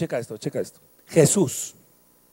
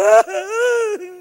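mock wail; 11 semitones higher, 6 dB louder than usual. Joke about crying devil.

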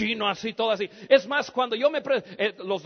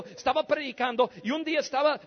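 usual speaking voice

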